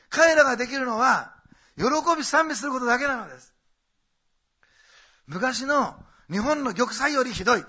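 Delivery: impassioned preacher pleading with the flock; preaching at full force. Acted. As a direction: drive the point home to the congregation.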